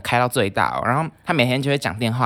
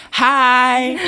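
cheerful hello, much higher and louder; bright hello; 13 semitones higher, 8 dB louder than usual. Retold or imitated. Speaker eagerly greeting the new roommate at the door. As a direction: throw out an eager friendly hi.

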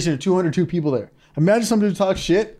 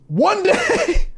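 incredulous. Real, not acted. Comic outrage peak.

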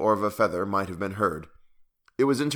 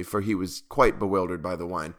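ordinary delivery, nothing marked

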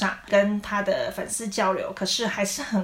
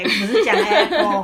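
laughing wrong apple; 7 dB louder than usual. Shown as a demonstration. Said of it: "我们不是讲".

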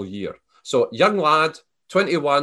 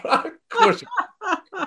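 much higher, slow, laughing through Of course you are; laughing repeat; slower than usual. Retold or imitated. Repeats reply while laughing.

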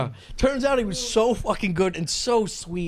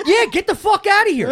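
raised, high-pitched brush-off; loud comic dismissal; mock angry rejection; 10 semitones higher, 6 dB louder than usual. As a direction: chase off an intruder, retold for laughs. Retold rejection of the intruder.

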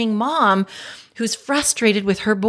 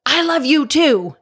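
angry "I love you too"; 6 semitones higher, 5 dB louder than usual. Retold or imitated. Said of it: "so angry as I'm speaking these words of love".

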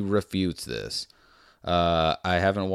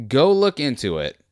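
repeating the order to viewers, louder and higher; emphatic; 5 semitones higher, 5 dB louder than usual. Shown as a demonstration.

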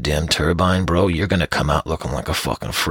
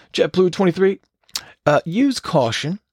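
mock tower warning; 12 semitones higher than usual. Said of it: "the tower goes".

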